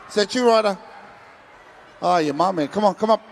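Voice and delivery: ordinary talk, nothing marked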